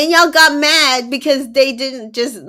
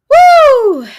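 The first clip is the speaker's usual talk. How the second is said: high, loud woo; loud "woo" of disbelief; exasperated whoop; 11 dB louder than usual. Real, not acted. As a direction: let out one long high whoop. Exasperated reaction to the date.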